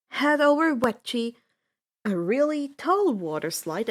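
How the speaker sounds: ordinary delivery, nothing marked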